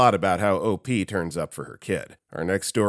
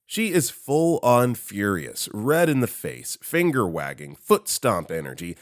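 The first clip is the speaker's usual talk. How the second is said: usual speaking voice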